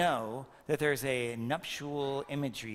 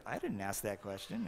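mock kid's retort; 6 semitones lower, 6 dB quieter than usual. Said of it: "You're like".

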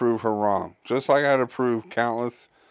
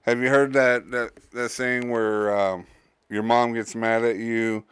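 ordinary delivery

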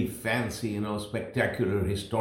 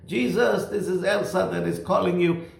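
calling out; 7 semitones higher than usual. Retold or imitated. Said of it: "she was just calling out to Jesus".

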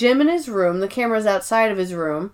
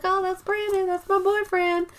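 high, soft gushing voice; imitated smitten girlfriend; 10 semitones higher, 6 dB quieter than usual. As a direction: coo it high and light with a smile. Val smiling, introduces her boyfriend.

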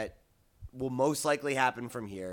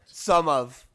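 "Some of" hit loud and high; stress on some; 5 semitones higher, 10 dB louder than usual. Retold or imitated. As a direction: punch "Some" in disbelief. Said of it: "I love when that word is used in that way".

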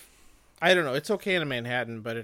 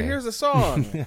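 high, loud put-on announcement; hammed-up show-line delivery; mock catchphrase; 10 semitones higher, 9 dB louder than usual. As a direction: belt out the running gag, pitched way up. Acting out a repetitive show line.

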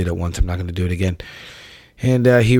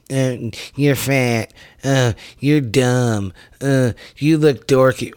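slow, drawn-out dopey taunt, pitched up; dumb mocking voice; 4 semitones higher, slower than usual. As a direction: mimic childish online insults, dragging each one. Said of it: "you type dumb shit such as".

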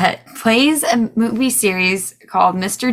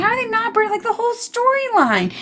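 high-pitched outraged disbelief; incredulous; faster than usual. Retold or imitated. Retelling her shock at a film list, high incredulous. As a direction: squeak it out, appalled that they aren't British. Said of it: "I'm like".

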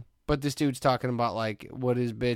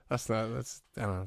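ordinary delivery

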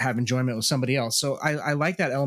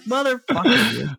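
mock annoyed outburst; 11 semitones higher, 6 dB louder than usual. Imagined listeners' outburst.

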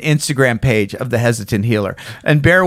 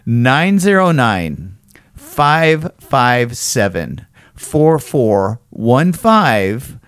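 slow, spaced-out phone number read; slow dictation; much slower than usual. Shown as a demonstration. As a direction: read the number out slowly for them to write. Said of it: "get a pen, get a piece of paper, and write this down".

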